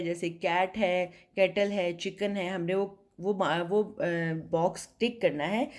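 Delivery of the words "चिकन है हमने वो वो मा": usual speaking voice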